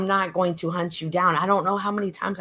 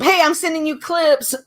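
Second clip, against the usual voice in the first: mocking imitation; 9 semitones higher, 4 dB louder than usual. Mimicking her partner's breezy message.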